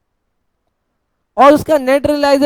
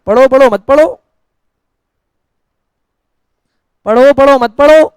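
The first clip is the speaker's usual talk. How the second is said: chanted take-it-or-leave-it taunt, a little louder; mock indifference; 4 dB louder than usual. Teacher answers students who won't study, mock indifferent taunt. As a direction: toss it at them in repeated rising phrases.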